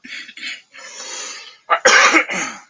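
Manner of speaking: harsh, hacking cough. Real, not acted. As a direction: cough hard into the mic. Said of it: "My apologies, guys".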